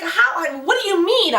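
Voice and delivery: retold baffled protest; bewildered disbelief. Retold bewildered question to doctor.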